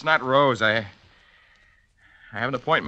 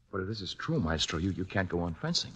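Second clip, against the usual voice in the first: hushed dismay; 4 semitones lower, 13 dB quieter than usual. Acted. Student shocked by the diagnosis.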